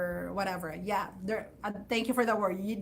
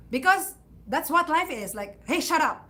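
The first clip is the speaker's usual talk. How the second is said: curt, louder strict parent brushing off; strict parent retort; 5 semitones higher, 4 dB louder than usual. Retold or imitated. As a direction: shut the kid down like an old-school parent. Strict parents shutting children down.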